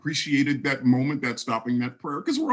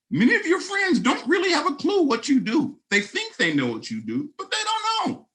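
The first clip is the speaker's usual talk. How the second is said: laughing; 14 semitones higher than usual. Real, not acted. Laughing at friends' ignorance.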